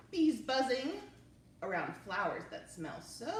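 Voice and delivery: lively, expressive read-aloud; reading to children. Acted. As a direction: read it to little kids with wonder. Reading picture book aloud.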